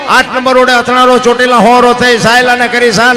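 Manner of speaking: rhythmic chanted verse recital, steady driving beat; bard's lilting verse delivery; folk poem recital. Acted. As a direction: recite the earthquake ballad like a village bard.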